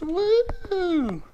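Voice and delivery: very high whoop; excited whoop. Real, not acted. Owner whooping along with the excited parrot, high whoop. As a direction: whoop with the bird.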